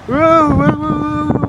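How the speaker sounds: high excited woo chant; excited cheering. Real, not acted. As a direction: cheer it like a fan. Cheering for idol's birthday.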